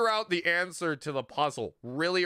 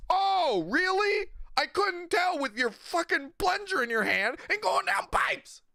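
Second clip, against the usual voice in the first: much higher, mock-amazed sarcasm; high mock astonishment; sarcastic; 13 semitones higher than usual. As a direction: sneer at the obvious reveal. Mocking reply to the Mario reveal.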